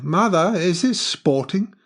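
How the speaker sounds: put-on high, loud boy questioning his mother; boy's voice. A narrator's reading. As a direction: raise the pitch like a boy, stretching the question. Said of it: "a boy's voice had said".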